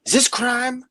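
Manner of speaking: very high, squeaky puzzled voice; high-pitched confused neighbor asking if it's crime or fun; mock bewilderment. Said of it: "And I'm like".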